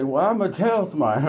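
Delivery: high, quick little threat; wife's threat. Retold or imitated. Wife threatening to tell on her husband, high and quick. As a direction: squeak it out fast like a scared wife. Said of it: "And they say".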